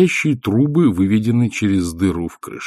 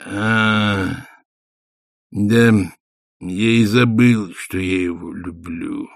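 glum; much slower than usual. A narrator's reading. Warrior husband conceding to his wife, mildly glum. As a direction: give in gloomily. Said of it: "мрачно сказала Война".

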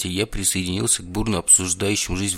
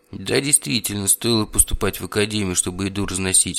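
plain reading voice

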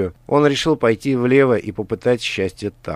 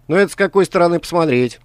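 higher, fast taunting villain; mustache twirling villain; 9 semitones higher, 6 dB louder, faster than usual. A narrator's reading. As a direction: needle him with quick mockery. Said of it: "не унимался Каргантус".

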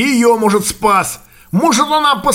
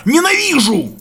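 higher, near-shouted outburst; almost shouting; 6 semitones higher than usual. A narrator's reading. Young man raging at being taught how to live, near-shout. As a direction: burst out, just short of a yell. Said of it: "Почти крикнул".

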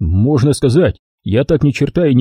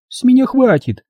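much higher-pitched, fed-up protest; drunken outcry; 11 semitones higher than usual. A narrator's reading. Dinka wants out of the crime, crying out drunkenly. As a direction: call out on a high note, fed up. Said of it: "пьяно выкрикнула Динка".

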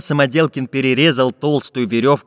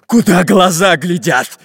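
loud, slow pirate yell; pirate's shout; 5 semitones higher, 5 dB louder than usual. A narrator's reading. Pirate chief to his thin robber, shouting. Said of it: "закричал пират Буль-Буль".

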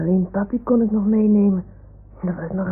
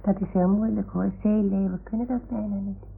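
soft teasing; 7 dB quieter than usual. Acted. Plagend zacht tegen de zeeleeuw.

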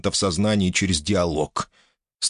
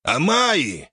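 growled; 8 semitones higher than usual. Acted. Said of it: "зарычал старик в ответ".

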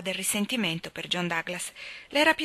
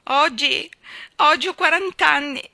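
higher, louder sobbing lament; 7 semitones higher, 8 dB louder than usual. A narrator's reading. Janet confesses her age to Anna, sobbing. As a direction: wail it through sobs. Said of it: "singhiozzò Janet".

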